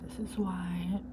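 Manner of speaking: soft hushed whisper. Real, not acted. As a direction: whisper it quietly. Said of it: "I'm trying to be quiet".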